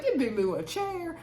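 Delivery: playful talk-back at the screen; mock urging. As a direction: tell them off from the couch. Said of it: "I was like".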